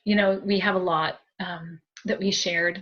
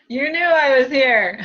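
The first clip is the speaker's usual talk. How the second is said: louder, slower, mock-accusing tease; playful teasing; 6 semitones higher, 7 dB louder than usual. Medium voicing the spirit sister's tease, louder and slower. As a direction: tease her like a sister caught peeking. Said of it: "she says".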